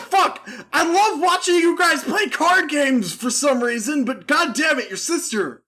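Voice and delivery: high-pitched comic outrage, half laughing; mock exasperation. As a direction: scold a friend in hammed-up disbelief. Acting Tristan scolding Joey.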